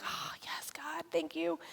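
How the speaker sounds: very soft, whispered praise; whispered thanks. Retold or imitated. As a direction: breath-only whisper of gratitude to God. Acted grateful prayer in her seat.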